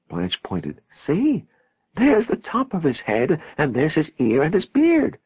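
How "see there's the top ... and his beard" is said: high, excited voice; high-pitched, marvelling explanation; thrilled pointing-out. A narrator's reading. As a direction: point out each feature in wonder.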